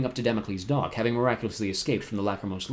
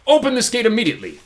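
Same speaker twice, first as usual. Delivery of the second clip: much higher and louder yell; shouting; 12 semitones higher, 9 dB louder than usual. A narrator's reading. Poe shouts at the locked gate, shouting. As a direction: demand it open. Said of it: "Mister Poe shouted".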